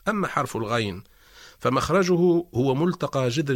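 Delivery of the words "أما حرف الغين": ordinary talk, nothing marked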